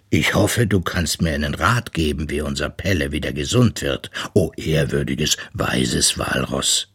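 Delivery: low, pleading bear voice; bittender Papa Eisbär. A narrator's reading. Worried bear pleads with the walrus. (tiefe, bittende Bärenstimme)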